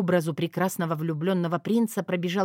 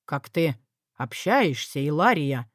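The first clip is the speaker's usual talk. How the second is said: lower, reproving male voice; disapproving husband; 3 semitones lower than usual. A narrator's reading. Husband rebukes his wife for her manners, disapproving. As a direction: scold her in a low husband's voice. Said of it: "неодобрительно нахмурился он".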